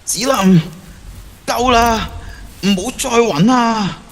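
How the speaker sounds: pleading call. Acted. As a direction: call her name, then beg her to stop. Yin begging Zilin to stop.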